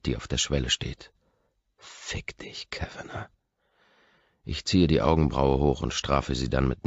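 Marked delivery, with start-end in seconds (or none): 1.8-3.3 unvoiced, barely audible insult; whispered insult. A narrator's reading. Man silently curses the roommate, whispered. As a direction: breathe it so only you hear it.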